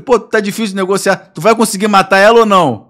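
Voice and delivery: hammed-up haggler losing patience; acted exasperation. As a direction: push the crook loudly for an answer. Exasperated haggling with kidnapper, retold.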